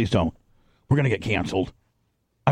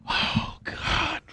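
breathless whisper; 7 dB quieter than usual. Real, not acted. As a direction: whisper it in disgust. Disgusted by the retching sound.

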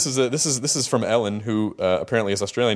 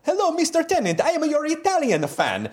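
higher-pitched hammed-up Italian accent; 13 semitones higher than usual. Interviewer reading an Italian fan's letter to David, mock Italian accent. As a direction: ham up a broken-English Italian fan. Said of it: "I'm gonna do another accent".

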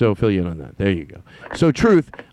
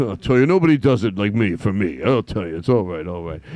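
low Danny impression; 9 semitones lower than usual. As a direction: drop low and echo his line. Mimicking Danny's catchphrase.

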